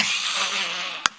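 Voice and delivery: long open demonstration laugh; Native-style laugh. Shown as a demonstration. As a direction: let out a long imitated belly laugh. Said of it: "they're laughing like natives".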